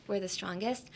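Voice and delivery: casual and unweighted; no emphasis. Shown as a demonstration. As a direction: say it evenly, no word picked out. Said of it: "without any emphasis on anything".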